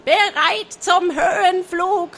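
high, excited clucking voice; cackling hen. A narrator's reading. The hen cackling eagerly to the pirates. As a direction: squawk it like a fussy hen. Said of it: "krähte es".

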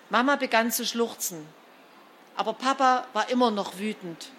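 ordinary delivery, nothing marked

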